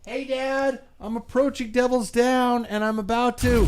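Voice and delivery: high-pitched excited rider calling in; host acts out a rider's live report to the show, pitched high; mock on-course report.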